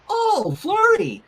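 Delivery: high, slow sing-song name; cooing at a baby. Acted. Rainbow cooing at baby Flurry, high and slow. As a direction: coo to the little one.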